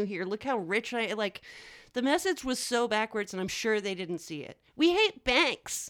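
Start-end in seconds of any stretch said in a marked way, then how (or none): 4.8-5.9 high, slow, whiny protest; whiny male voice. Retold or imitated. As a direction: drag it out like a whiny rich guy. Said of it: "I was making a very whiny male voice".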